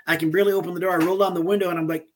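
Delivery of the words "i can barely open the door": ordinary talk, nothing marked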